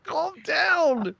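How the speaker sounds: high-pitched mock plea; high, worked-up scolding; exasperated plea. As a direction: plead with him in exasperation. Acted plea to a character.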